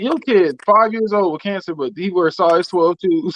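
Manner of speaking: normal talking voice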